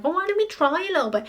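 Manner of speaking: lightly voiced sly old man, hurried; tempting mock Grandpa Joe. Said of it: "because he's like".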